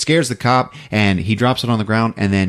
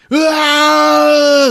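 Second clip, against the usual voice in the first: sudden high, loud far-off shriek of pain; agonized scream; 6 dB louder than usual. Acted. Host acting out the tortured man's scream. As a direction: scream as if being ripped apart. Hero screaming while torn apart.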